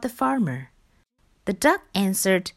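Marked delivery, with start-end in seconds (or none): none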